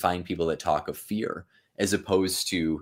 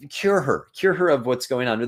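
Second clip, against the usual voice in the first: lightly voiced, much higher pleading king; paraphrased Macbeth; 7 semitones higher than usual. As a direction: beg casually in modern words. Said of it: "He's like".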